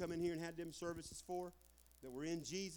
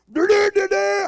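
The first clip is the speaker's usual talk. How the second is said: loud, high trumpet fanfare with the voice; imitated trumpet blast; 12 semitones higher, 16 dB louder than usual. Preacher demonstrating the rapture trumpet, blaring vocal fanfare. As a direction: blare it out like a trumpet.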